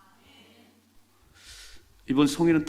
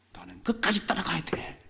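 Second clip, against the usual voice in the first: resolute whisper; 6 semitones higher than usual. Acted. Elisha's inner resolve.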